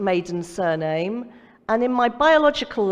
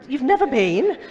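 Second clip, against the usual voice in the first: higher-pitched incredulous question, with a laugh; breathless disbelief; 4 semitones higher than usual. Retold or imitated. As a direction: imitate being gobsmacked by a friend's answer. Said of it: "Couldn't even catch my breath".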